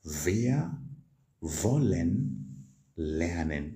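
slow, careful German model line; slow model sentence. Shown as a demonstration. Teacher slowly modelling the German sentence for students. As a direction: draw out wollen lernen slowly. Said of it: "How do you say that?".

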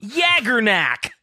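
high, slow, drawn-out anime exclamation; anime character. Acted. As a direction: stretch out Yaggernak like an amazed anime hero. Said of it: "why did you sound like ... an anime character".

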